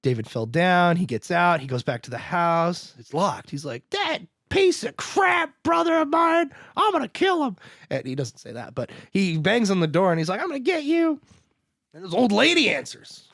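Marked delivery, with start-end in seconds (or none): 3.9-7.6 hammed-up outrage, pitched way up; mock angry yell. Acted furious kid at locked door. 10.4-11.2 high-pitched mock angry yell; high, hammed-up threat. Acted threat while banging on door.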